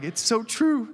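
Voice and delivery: high-pitched tearful gush, played for effect; mock bawling. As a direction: act out being moved to tears. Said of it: "it was like I was bawling".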